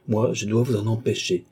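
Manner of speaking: ordinary delivery, nothing marked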